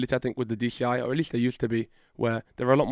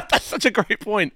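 laughing mockery; 7 semitones higher, slower than usual. Real, not acted. Mocking the judges' response.

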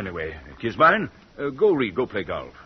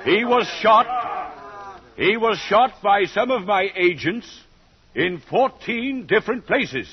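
menacing host; 10 semitones higher than usual. Acted. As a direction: savor the bad news as the genial villain. Host gloats over escaped guest.

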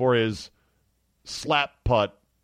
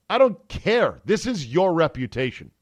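high, loud dismissal; emphatic rant; 5 semitones higher, 5 dB louder than usual. Real, not acted. Host dismissing Mickelson's apology, loud and high. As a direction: throw it away hard.

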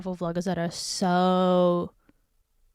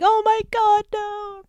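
mock horror; 13 semitones higher, 4 dB louder, slower than usual. Friend watching the fake shooting, mock horror. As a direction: imitate a horrified friend.

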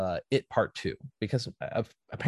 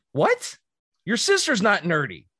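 retold disbelief; 8 semitones higher, 5 dB louder than usual. Retold own incredulous reply.

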